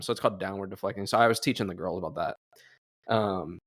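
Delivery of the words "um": usual speaking voice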